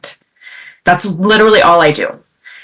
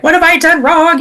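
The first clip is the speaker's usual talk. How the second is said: "What have I done wrong?" pitched up high in mock panic; 12 semitones higher than usual. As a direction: voice her inner panic, high and quick. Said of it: "my own conditioning of, like".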